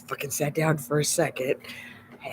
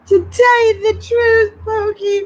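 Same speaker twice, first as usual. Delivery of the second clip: mock whine; 10 dB louder, slower than usual. Real, not acted. High wailing mock complaint.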